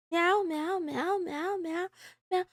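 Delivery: soft, fading kitten meows; trailing off into tiny meows; playful meows. Pretend. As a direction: meow at chat like a sleepy cat. Playful cat noises to chat.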